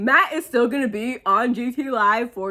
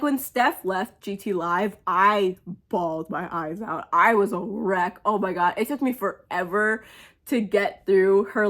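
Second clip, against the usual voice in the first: ordinary talk, nothing marked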